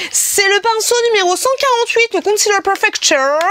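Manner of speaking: very high, sing-song product presentation; mock YouTuber voice. Presenting brush number 148, high mock YouTuber voice. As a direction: squeak out the product name like a parody vlogger.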